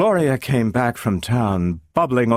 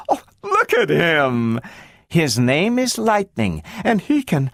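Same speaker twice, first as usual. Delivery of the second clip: higher soothing mom, a touch strained; mother distracting daughter with the dog; consoling mother; 8 semitones higher than usual. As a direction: comfort while hiding the truth.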